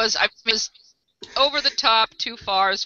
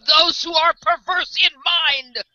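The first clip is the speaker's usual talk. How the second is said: high, impassioned parody sermon; hammed-up preaching; mock street preacher; 9 semitones higher, 4 dB louder than usual. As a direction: cry it out like a street evangelist.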